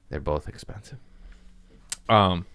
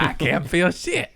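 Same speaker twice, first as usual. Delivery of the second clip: much higher, goofy voice with a laugh in it; imitating himself high; 10 semitones higher than usual. Himself on pain pills, giddy and high. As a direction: play his stoned self, giddy. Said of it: "I'll be like".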